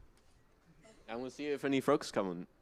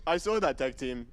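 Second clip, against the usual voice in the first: loud, higher, half-laughing mock scolding; mock anger; 6 semitones higher, 4 dB louder than usual. Host mock-scolding the audience for peeking, loud half-laughing telling-off. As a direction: playfully tell off the crowd, hammed-up. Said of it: "I'm getting angry".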